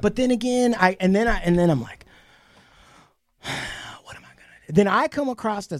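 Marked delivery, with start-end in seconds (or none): 3.4-4.7 hushed, sighed self-question; resigned sigh. Retold or imitated. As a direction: sigh it out softly, as if to yourself. Acts out own resigned sigh.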